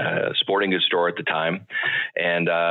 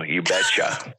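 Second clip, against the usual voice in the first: put-on Minnesota accent; slower than usual. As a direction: call out "you betcha" like a northern Minnesota local. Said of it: "Now you sound like a Minnesotan".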